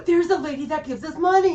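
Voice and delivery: gasp, then high, loud imitation of thrilled kids; excited kids. Kids spotting the lady who gives them money, excited kids. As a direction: mimic children spotting someone with a laugh.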